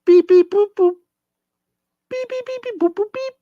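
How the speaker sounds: very high electronic beep-boop noises; phone dial beeps. Pretend. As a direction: imitate a keypad dialling. Dialling a phone for the skit.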